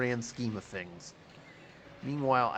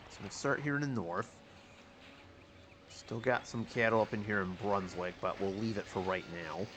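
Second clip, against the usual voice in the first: ordinary talk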